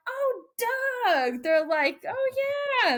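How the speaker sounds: high-pitched, imitated chorus of recognition; drawn-out, high "oh" of happy recognition; delighted recognition. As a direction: mimic a crew gushing over an old friend. Crew delightedly recognizing the visitor.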